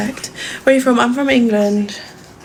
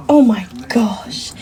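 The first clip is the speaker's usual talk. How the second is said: whisper. Real, not acted. Gushing over her dog.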